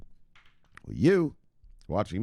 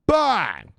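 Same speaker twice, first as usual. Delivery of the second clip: loud bang shout; 13 semitones higher, 9 dB louder than usual. Real, not acted. Reads all-time high price.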